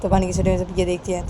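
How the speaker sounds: usual speaking voice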